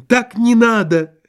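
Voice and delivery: high, insistent lament; exclaimed protest. A narrator's reading. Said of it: "воскликнул Лоханкин".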